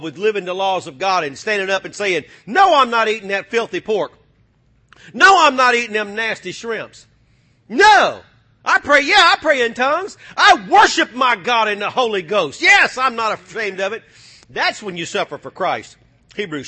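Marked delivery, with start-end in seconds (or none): 2.5-14.0 defiant believer. Pretend. Believer's defiant declaration voiced.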